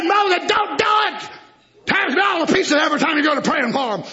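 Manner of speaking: God shouting. Acted. Preacher voicing God, shouted.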